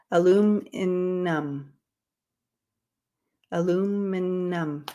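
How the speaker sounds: aluminum said slowly with every syllable clear; slow careful word. Shown as a demonstration. Getting aluminum right for chat. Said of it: "Oh my gosh, it worked".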